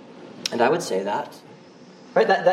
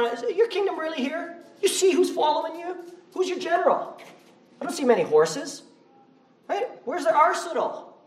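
mocking Pharisees; 11 semitones higher than usual. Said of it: "The Pharisees are".

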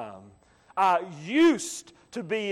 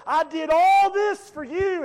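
pleading cry; 6 semitones higher, 4 dB louder, much slower than usual. Acted. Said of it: "Jesus said they'll be meaning that day, say".

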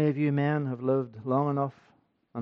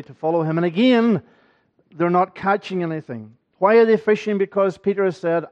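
raised, higher and louder pulpit preaching; fervent preaching; 4 semitones higher, 8 dB louder than usual. Acted. Preacher rousing the congregation about Peter's restoration, fervent. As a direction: drive the sermon home with rising fervour. Preacher builds to full force.

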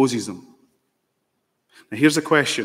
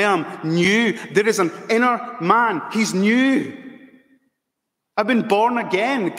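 much higher, ringing joyful proclamation; exultant Paul; 9 semitones higher than usual. Acted. Preacher voicing Paul's joy at new birth, soaring and exultant. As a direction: rise up in triumphant joy at being made new. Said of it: "He's saying".

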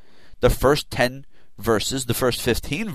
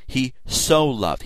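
"so" held and pressed, slowly; stress on so; 3 semitones higher, slower than usual. Shown as a demonstration. Preacher stressing "so" to show God's intense love. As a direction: land hard on "so" in "He so loved". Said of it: "emphatically used in this expression".

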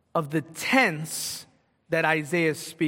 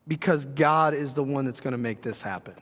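ordinary talk

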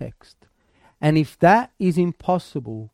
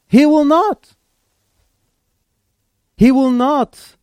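loud, higher "He will not"; emphatic shout; 6 semitones higher, 6 dB louder, slower than usual. Shown as a demonstration. Preacher declaring it boldly to the church, loud. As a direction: ring it out to the room. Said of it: "I'm just gonna take a stab at this".

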